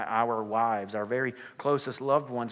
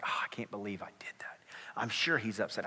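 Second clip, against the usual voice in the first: regretful whisper; 2 semitones lower, 5 dB quieter than usual. Retold or imitated. Pastor voicing the wife's regret to the congregation, whispered.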